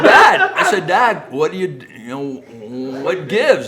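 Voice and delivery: high, loud surprised calling of Dad; incredulous, laughing; shocked question. Retold or imitated. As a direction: son asking his dad in disbelief.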